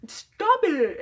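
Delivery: shrill-high mock yell of a scolding mom. Friend's mom yells at him over the dog, high mock yell. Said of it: "like, yelling at me".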